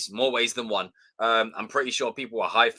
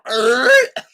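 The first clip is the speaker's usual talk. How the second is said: short, high, loud rising noise; imitated zip noise; 13 semitones higher, 11 dB louder than usual. Streamer describing Jonah's haircut, comic rising noise. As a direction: make hair vanishing into a sound effect. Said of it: "Jonah's hair has just gone".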